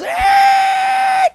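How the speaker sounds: a sudden high yelp of alarm; startled yelp. Retold or imitated. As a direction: yelp like a driver about to crash. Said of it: "The P T Cruiser in front of me goes".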